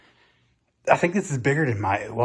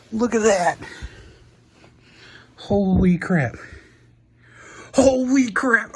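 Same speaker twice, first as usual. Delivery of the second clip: much higher, louder amazed exclaim; excited; 9 semitones higher, 4 dB louder, much slower than usual. Real, not acted. Gasping at the view outside, loudly amazed. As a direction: burst out in real amazement at the view.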